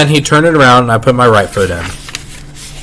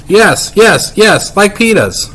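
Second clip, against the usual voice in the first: hollering; 9 semitones higher, slower than usual. Acted. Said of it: "Fudge hollered".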